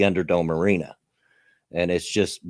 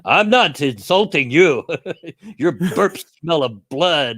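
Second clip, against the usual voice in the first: quoting a game character; 6 semitones higher than usual. Retold or imitated. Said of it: "he says".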